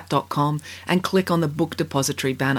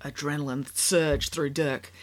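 plain reading voice

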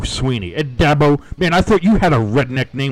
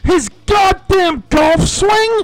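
high, slow, disbelieving yell; incredulous shout; 4 dB louder, much slower than usual. Real, not acted. Mocking the coach's answer.

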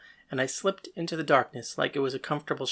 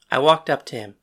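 ordinary delivery, nothing marked

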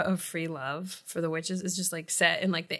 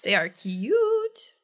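slow, drawn-out admiring; slow cute drawl; 4 semitones higher, 4 dB louder, much slower than usual. Acted. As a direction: stretch the words out dreamily.

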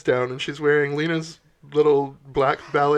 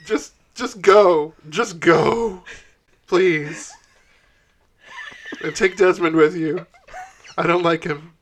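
louder, slow, firm shooing; insistent dismissal; 5 dB louder, slower than usual. Acted. [name] waving them off, louder insistent shooing. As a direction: push them out the door, loud and slow. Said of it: "she waves it at them".

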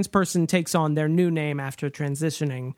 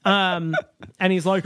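usual speaking voice

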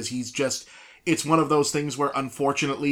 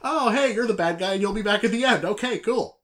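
much higher, quicker mock-obvious aside; mock viewer realization; 8 semitones higher than usual. Friend mimics viewers spotting the obvious villain, mock sing-song. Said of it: "It's like".

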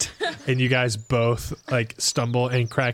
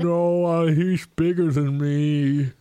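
higher, slow, sulky ogre voice; whiny ogre; 5 semitones higher, slower than usual. Acted. Ogre complaining to the party about bullies, whiny. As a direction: whine it like a hurt giant.

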